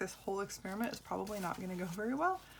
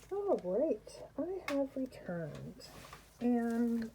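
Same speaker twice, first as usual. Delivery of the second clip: talking through a respirator mask; muffled through a respirator; 4 semitones higher, much slower than usual. Real, not acted. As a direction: speak muffled behind a mask. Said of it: "I have my respirator on".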